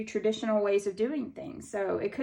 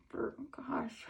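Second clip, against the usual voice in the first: whispered gosh; 3 semitones higher, 10 dB quieter than usual. Real, not acted. Sighing aside about binge-watching.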